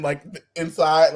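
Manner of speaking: ordinary delivery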